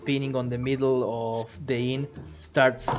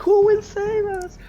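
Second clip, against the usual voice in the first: high, raised mock-panic plea; parents playing along; 5 dB louder than usual. Parents playing along for the kids, mock panic. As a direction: echo the staged alarm in a high voice, less forceful. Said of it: "some of the parents are like".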